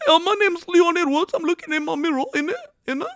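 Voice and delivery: high, loud hammed-up British accent; a mock Brit hyping himself in the mirror. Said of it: "That's how British people sound to me".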